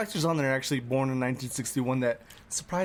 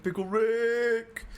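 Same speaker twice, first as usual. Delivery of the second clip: cartoon catchphrase; 8 semitones higher, much slower than usual.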